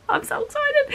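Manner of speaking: tiny high-pitched squeal; real excitement. Real, not acted. Vlogger announcing her unboxing vlog, excited squeal. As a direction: squeak it out, giddy.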